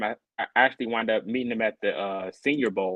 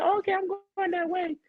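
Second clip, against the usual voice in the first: high put-on voice for the cart lady; lightly voiced cart driver; 4 dB quieter than usual. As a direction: mimic her chirpy offer, much higher.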